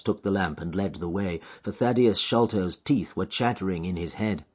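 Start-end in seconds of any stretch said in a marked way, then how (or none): none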